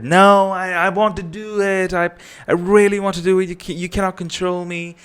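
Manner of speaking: high, loud mock tantrum yell from a child; imitated kid tantrum. Kid yelling at the parent who told them to stop, high mock tantrum. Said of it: "the kid starts yelling that".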